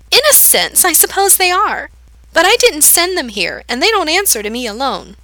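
put-on creepy girl amused by the question, high and playful; manic girl. A narrator's reading. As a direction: toy with him like a cat with a mouse. Manic girl answers Gerard.

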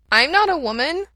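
a small dog objecting; little dog protesting to Mikey. A narrator's reading.

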